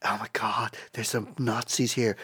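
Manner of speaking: hushed, low imitation of a tattletale; mock alarmed whisper. Geek at a bar tips people off, mock whisper. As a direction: whisper it like a secret report, mocking. Acting out man tipping off online.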